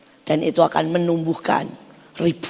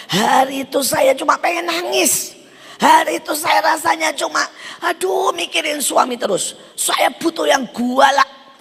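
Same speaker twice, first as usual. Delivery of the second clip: higher, half-hammed retelling of her lament; mock wail; 8 semitones higher than usual. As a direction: play her desperate plea, a touch overdone. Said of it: "dia bilang begitu".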